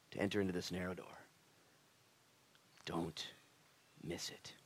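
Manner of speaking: low, soft, fading to whisper; quiet urgent warning with long pauses; hushed plea. Acted. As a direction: drop to a grave hush. Hushed end of God's plea.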